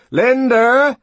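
loud, high-pitched call. A narrator's reading. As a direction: call to someone in another room. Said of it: "the young man called".

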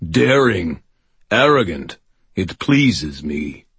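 imperious, booming ancient hunter; menacing fae king voice. Acted. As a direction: speak like a cold fae lord. Earl King speaks again.

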